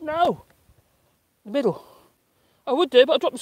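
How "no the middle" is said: usual speaking voice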